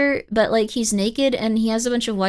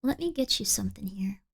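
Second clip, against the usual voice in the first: whisper; 3 semitones lower, 9 dB quieter than usual. Real, not acted. Whispers while tired.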